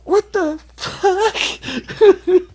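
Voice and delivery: very high, very slow stunned cry; drawn-out high shock. Retold or imitated. Friends learning they took shrooms, high stunned cry. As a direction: stretch it out in disbelief. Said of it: "They said".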